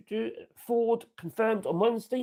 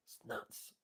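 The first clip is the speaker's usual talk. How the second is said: whispered; 20 dB quieter than usual. Real, not acted. Aside on tariff ignorance.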